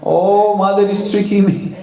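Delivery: high, drawn "oh" then quick words; sudden realization. A narrator's reading. Son realizes his mother is tricking him, surprised. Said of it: "And then Krishna realized".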